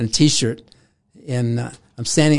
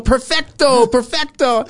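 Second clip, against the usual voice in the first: put-on high, louder Italian-accented Perfecto, Perfecto; Italian delight; 11 semitones higher, 4 dB louder, slower than usual. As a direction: savour it like a thrilled Italian director. Antonioni delighted, Italian word.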